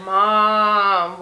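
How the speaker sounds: long, loud, drawn-out Mom; wail-length call to mom, held loud; dragged out. Retold or imitated. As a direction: stretch the one word right out. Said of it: "one dragged out message".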